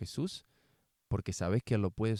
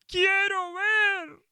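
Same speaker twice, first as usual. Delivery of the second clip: very high, loud, slow plea; pleading loud cry; 8 dB louder, much slower than usual. Acted. Blind man begging Jesus to let him see, high and loud. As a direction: beg with all he has.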